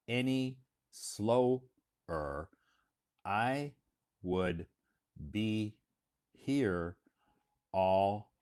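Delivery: one word at a time, long pauses; exaggerated slow-motion delivery, each word stretched out; sarcastically slow. Shown as a demonstration. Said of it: "I'm just being sarcastic".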